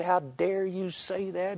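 indignant challenge, held back in volume; retold indignant yell. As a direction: retell an offended father's outburst. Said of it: "he yelled at me".

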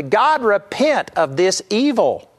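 lightly acted finger-shaking command, drawn out; finger-wagging demand. Moses confronting God to his face, finger-wagging demand. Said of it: "shake his finger in the face of".

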